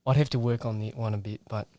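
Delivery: ordinary talk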